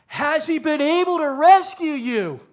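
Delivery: high, loud, desperate question; anguished yell. Acted. King yells into the lions' den for Daniel, anguished yell. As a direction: cry it out at the top of your voice. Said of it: "he yells at the pit".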